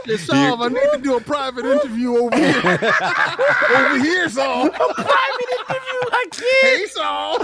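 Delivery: laughing mock come-on; creepy invite cracking up laughing.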